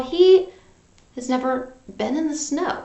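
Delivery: ordinary talk, nothing marked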